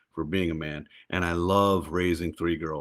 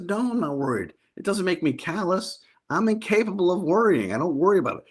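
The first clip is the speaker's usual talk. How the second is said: mock defensive; 11 semitones higher than usual. Husband defends himself to wife.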